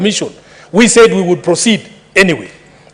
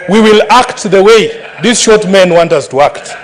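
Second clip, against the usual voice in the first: ordinary talk, nothing marked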